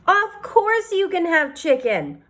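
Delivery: high, slow kind assurance; reassuring waitress. A narrator's reading. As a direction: reassure the worried boy gently.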